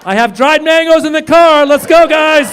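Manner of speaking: high loud call to leave; eager shout. Acted. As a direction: shout it like the best news all day. Doctor quitting mid-surgery.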